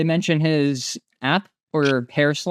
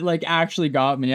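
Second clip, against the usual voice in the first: normal talking voice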